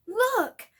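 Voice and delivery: high, loud angry burst. Acted. Harry to Snape, an angry loud burst. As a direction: snap it out. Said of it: "said Harry angrily".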